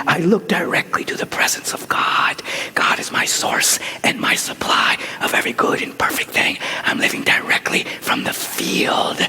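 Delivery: hushed, breathy prayer whisper; whispered affirmation. Acted. Preacher to congregation, whispering the affirmation.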